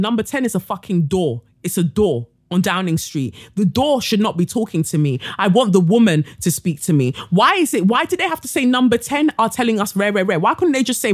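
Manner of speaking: ordinary talk, nothing marked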